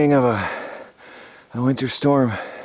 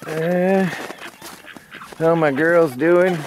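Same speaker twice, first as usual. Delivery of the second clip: higher, slow coo to the chickens; a higher, drawn-out hello to the hens; pet talk to hens; 7 semitones higher, much slower than usual. Real, not acted. Greeting his hens fondly.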